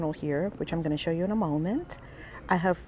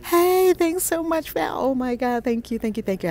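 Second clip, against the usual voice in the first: gushing thanks; 8 semitones higher than usual. Real, not acted. Host thanking a viewer for support, gushing high thanks. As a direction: gush your thanks.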